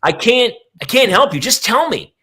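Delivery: very high, strained, desperate begging; exasperated high plea. Retold or imitated. Mother pleads with daughter to explain, high exasperated voice. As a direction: push it up high in frustration.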